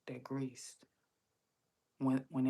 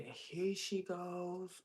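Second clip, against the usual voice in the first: higher, slow imitated greeting; drawn-out greeting; 4 semitones higher, much slower than usual. Pantry lady greeting her in line, drawn-out. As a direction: stretch it out, mimicking a greeter.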